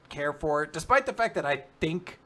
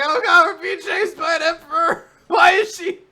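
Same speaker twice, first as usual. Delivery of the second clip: high, much louder crying-out panic; panicked scream; 14 dB louder than usual. Retold or imitated. Fleeing an emperor in a tank, high panicked screaming. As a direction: scream it like you're being chased. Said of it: "And I'm like".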